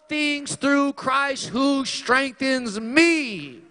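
fiery pulpit voice; full-force preaching. Acted. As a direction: cry out the scriptures as a declaration.

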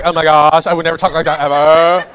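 loud, hammed-up scandalized girl; faddish girl talk. Mocking how girls used to talk, faddish girl talk. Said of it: "Talking that way was very faddish".

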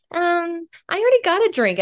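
high-pitched, hesitant brush-off; squeaky, uneasy politeness; awkward polite refusal. Retold or imitated. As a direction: turn down a stranger in a high, awkward voice. Awkwardly turning down a stranger.

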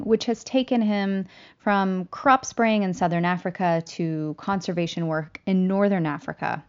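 ordinary delivery

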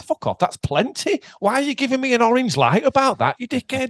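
high-pitched, exasperated rant; indignant comic complaint, pitched well up; mock outrage. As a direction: tell off a useless machine. Acted quarrel with the warning light.

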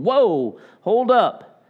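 a sudden high, loud whoa, stopping them short; startled halt. Retold or imitated. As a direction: pull them up short, caught off guard. John stops the questioning leaders.

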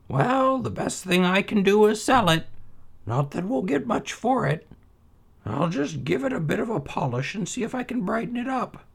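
put-on high old mother's voice, dismissive. A narrator's reading. Mother doubting her son's tale, high and dismissive.

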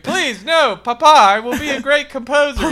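high, loud, desperate plea; pleading child. Acted. Child Hans begging his father, high loud plea. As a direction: beg Papa, hammed up.

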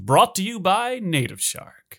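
upbeat podcast host intro; announcer voice. Acted. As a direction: announce the show brightly. Reads the show opening.

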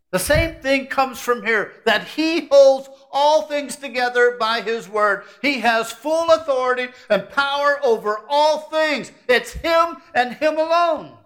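slow, rising preaching up to a shout; full-force preaching. Acted.

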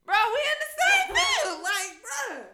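loud, high-pitched disbelieving yell; exasperated outburst. Real, not acted. Daughter realizing she and mama share a field, exasperated yelling. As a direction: shout it high, unable to believe it.

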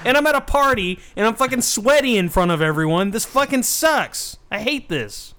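mock-distressed whining outburst; miserable complaint. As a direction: protest in hammed-up misery. Acting miserable dancer's complaint.

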